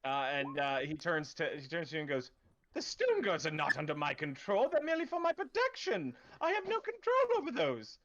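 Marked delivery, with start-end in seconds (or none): 2.7-8.0 much higher, pleading official's voice; nervous town official. Acted. Threatened official insists to the player he can't command the guards, high and pleading. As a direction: squeak out the denial of control.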